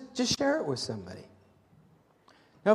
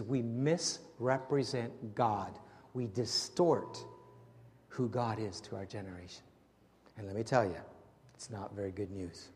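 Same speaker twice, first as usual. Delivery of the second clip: fading to hushed; 9 semitones lower, 10 dB quieter than usual. Real, not acted. Preacher fading into solemn close.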